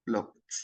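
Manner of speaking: "looked" said as "lookt"; final ED as T. Shown as a demonstration. Teacher contrasting base and past, "looked" with T ending. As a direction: end "looked" on a voiceless T. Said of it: "بننطق ال final E D as".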